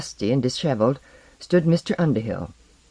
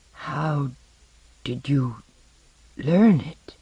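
very slow; slower than usual. Acted. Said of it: "he asked very slowly".

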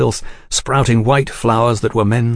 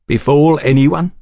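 higher-pitched challenging echo; 5 semitones higher, 4 dB louder than usual. A narrator's reading. Velasco questioning Oliphant's bland phrase, challenging. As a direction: throw the phrase back as a challenge. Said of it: "Velasco challenged".

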